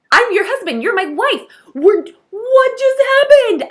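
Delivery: overjoyed newlywed losing it; freaking out. Retold or imitated. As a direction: couple freaking out after the ceremony, squealing. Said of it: "just held each other and went".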